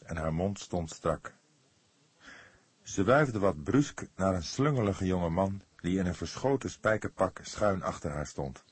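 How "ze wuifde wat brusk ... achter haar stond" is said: ordinary delivery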